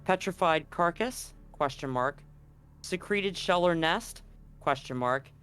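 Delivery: ordinary talk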